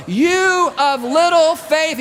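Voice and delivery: slow, high, loud call; loud rebuke. Retold or imitated. Jesus yelling to panicked disciples over storm, loud rebuke. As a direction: shout the rebuke over the wind. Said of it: "He's having to yell".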